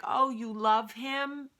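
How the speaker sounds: high, drawn-out mock sympathy; patronizing. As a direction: imitate a condescending grandmother, stretching the words. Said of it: "So patronizing".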